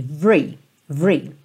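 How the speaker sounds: "vree" said as one quick syllable, V straight into R; teacher isolates the "vree" sound. Shown as a demonstration. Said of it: "Você pensa como se fosse V R".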